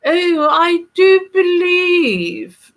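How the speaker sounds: mock the posh English aristocracy with a drawn-out plummy accent; exaggerated upper-class English accent, sneering at the aristocratic set. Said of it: "which would make you nauseous to hear it".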